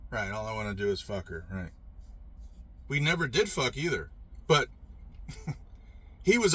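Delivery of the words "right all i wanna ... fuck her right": low, softer sarcastic echo of an accusation; dry sarcasm. Retold or imitated. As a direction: brush it off with dry mock agreement. Mockingly echoes the boyfriend's accusation.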